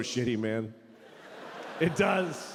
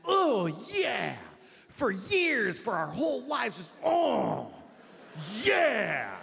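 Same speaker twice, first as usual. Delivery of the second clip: mock dreamy expectation; 5 semitones higher, slower than usual. Mocking forever-love expectation.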